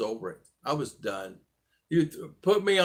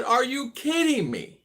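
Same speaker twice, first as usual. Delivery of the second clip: high, slow, stunned disbelief; high, drawn-out are you kidding me; incredulous; 11 semitones higher, slower than usual. Retold or imitated. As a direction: say it like you can't believe a gun is pointed at you. Disbelief at robber with gun.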